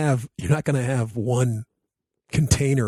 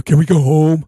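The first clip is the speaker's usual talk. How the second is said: put-on whiny kid; 4 semitones higher, 11 dB louder than usual. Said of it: "as opposed to".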